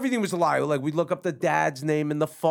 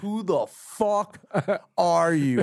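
slow, drawn-out mock disbelief; incredulous demand; much slower than usual. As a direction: stretch it out like you can't believe it. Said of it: "It was like".

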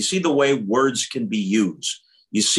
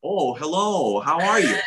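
lilting broadcaster impression, slightly higher; Vin Scully; 6 semitones higher than usual. Contrasting him with Bronx talk, lilting announcer impression. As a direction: greet like a genteel announcer being imitated. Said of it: "But Vin Scully talk".

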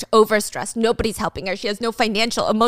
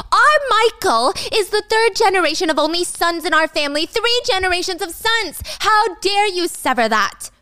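high, louder mother-in-law scolding; 8 semitones higher, 3 dB louder than usual. Retold or imitated. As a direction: lecture her like a furious mother-in-law. Mother-in-law scolds Gia at table.

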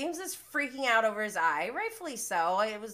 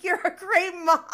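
high, loud mimicry breaking into laughter; mock outburst; 9 semitones higher, 8 dB louder than usual. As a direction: blurt his silly comeback, about to crack up. Mimics his comeback, laughing.

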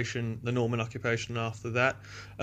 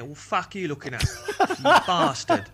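put-on higher, rough London accent breaking into a laugh; 7 semitones higher, 5 dB louder than usual. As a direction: snarl it like a London punk. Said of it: "London's more like that, innit?".

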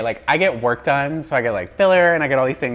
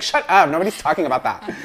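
mock retort; 6 semitones higher, 6 dB louder than usual. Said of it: "I always hear the voices".

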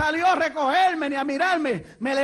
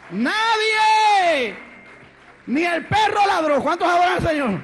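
raised, fervent sermon shout; full-force preaching; 4 semitones higher, 4 dB louder than usual. Real, not acted. Preacher proclaiming God's plan to the congregation, full-force preaching. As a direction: preach it out, stretching the big endings.

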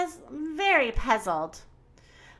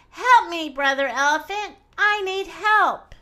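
calling for help; 5 semitones higher than usual. Acted. Pounced-on boy calls Mother Crocodile, frantic. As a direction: call out desperately to the crocodile.

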